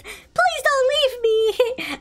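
high, slow, laughing plea; pleading child voice. Acted. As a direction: whine it like an abandoned kid. Child character begs mom.

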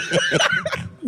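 high burst of real laughter; high helpless cackle. Real, not acted. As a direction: crack up completely. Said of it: "That's why I'm laughing".

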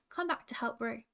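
ordinary delivery

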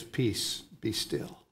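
calm the storm with a whisper; hushed command. Retold or imitated. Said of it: "And he says".

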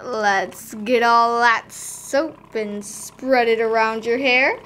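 ordinary delivery

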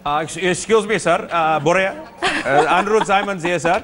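high, put-on South Indian accent, every line ending in sir; mock South Indian accent. Andrew asks Boria for a trip to the final, in a put-on South Indian accent. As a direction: play a cheeky South Indian fan begging a favour in the accent. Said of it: "with that beautiful South Indian accent".

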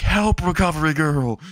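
high-pitched mock cry for help; acted plea to a rescuer; calling for help. Character calls out for rescue.

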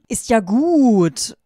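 loud, high, long-drawn exclamation; calling out. A narrator's reading. Two boys give in to her, calling out. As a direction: stretch it into a high, loud call. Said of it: "Riefen Zayn und".

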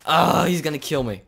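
loud panicked cry; panicked yell. Real, not acted. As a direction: yell it while fleeing for your life. Fleeing creeper, panicked.

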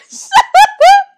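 loud, very high cackle of relief; startled high laugh. Real, not acted. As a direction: burst out in squealing laughter. Reaction to the scare.

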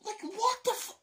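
high, loud squeal of disbelief; exasperated squeal. Real, not acted. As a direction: shriek it out in comic despair. Exasperated outburst at packaging.

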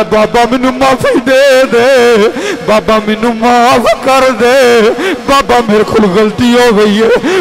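slow, high, loud wailing plea; pleading son. A narrator's reading. Son begging the father who disowned him, slow wailing plea. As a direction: drag each word out, begging for mercy.

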